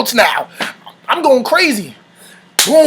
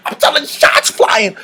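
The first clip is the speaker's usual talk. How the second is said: normal talking voice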